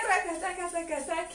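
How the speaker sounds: rapid "rakataka" percussion with the mouth; rhythmic vocal rattle. Shown as a demonstration. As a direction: sound out the hip trembling beat. Teacher voices the trembling rhythm.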